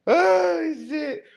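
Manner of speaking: high, squeaky end of a laughing fit; laughed out. Real, not acted. Man recovering from his giggles, laughed out high. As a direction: sigh it out high after laughing hard.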